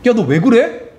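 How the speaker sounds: put-on an older brother demanding an answer; Cain confronting his brother. Cain questions Abel, acted dialogue.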